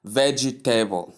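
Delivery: "vegetable" in four syllables, VEJ-ee-TAY-bul; wrong pronunciation. Shown as a demonstration. Teacher shows learners the mistake in vegetable, wrong four-syllable pronunciation. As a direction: say "vegetable" with an added EE and a TABLE ending. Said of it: "some people pronounce it as what".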